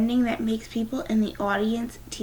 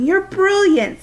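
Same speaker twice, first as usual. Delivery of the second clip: excited praise; 11 semitones higher, 8 dB louder, slower than usual. Acted. Dad praising daughter after play.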